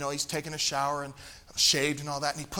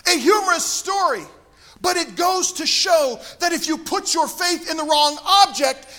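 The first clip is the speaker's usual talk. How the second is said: loud, high preacher voice; preaching; 10 semitones higher, 9 dB louder than usual. Acted. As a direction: preach it out with fervour. Preaching at raised force.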